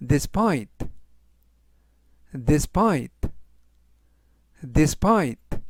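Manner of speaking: "despite" said three times, dis-PITE, stress on the end; pronunciation drill. Shown as a demonstration. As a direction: repeat "despite" slowly for students to copy. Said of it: "라고 발음하시면 되겠고요". ("despite"를 세 번 또박또박 발음 시범)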